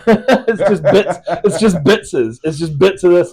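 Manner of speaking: laughing it out, high and loud. Real, not acted. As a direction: giggle through the words, playful. Joking about his mongrel accent.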